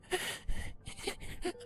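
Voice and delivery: a high, breathy crying gasp; a sob caught in the throat; choked sob. Acted. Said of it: "Scootaloo choked out".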